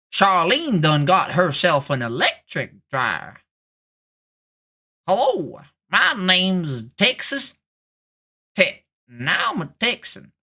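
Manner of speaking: hammed-up Texas accent, lightly done; a Texan playing it up, drawling the vowels. Streamer performs a Texas accent for chat, hammed-up. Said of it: "You want me to do a Texas accent?".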